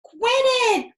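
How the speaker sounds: very high, loud exasperated cry. A narrator's reading. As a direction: yell it in exasperation. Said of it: "cried Fox".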